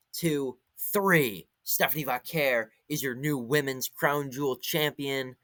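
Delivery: excited ringside commentary, slowing at the pin; play-by-play call. Acted. Recapping the street fight finish to viewers as a hyped play-by-play call. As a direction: call the finish like a live announcer. Calls the title finish live.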